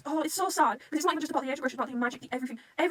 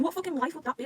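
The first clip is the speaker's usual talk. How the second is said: ordinary talk, nothing marked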